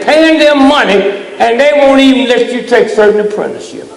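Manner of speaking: street-corner sermon at full blast; fiery street preaching. Real, not acted. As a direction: preach it at full force, hammering each point. Preacher building to full force.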